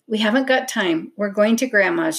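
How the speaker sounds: ordinary delivery, nothing marked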